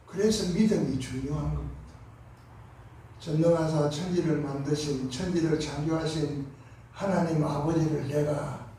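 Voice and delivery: ordinary delivery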